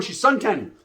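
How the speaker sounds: ordinary talk, nothing marked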